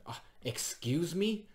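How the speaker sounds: high, slow, shocked excuse me; offended surprise. Retold or imitated. Heckler taken aback by the comic's insult, high and slow. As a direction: retell her offended gasp. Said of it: "she just went".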